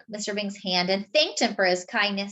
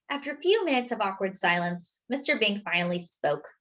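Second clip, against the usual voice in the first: usual speaking voice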